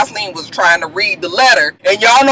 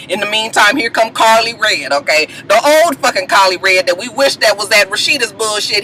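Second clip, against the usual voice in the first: ordinary talk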